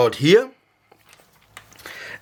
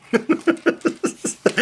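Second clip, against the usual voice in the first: giggling at own joke; short laugh, pitched up; high laugh; 12 semitones higher than usual. Real, not acted.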